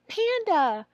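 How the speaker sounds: put-on high child's answer; student answer. Shown as a demonstration. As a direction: answer like a pupil. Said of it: "let them say".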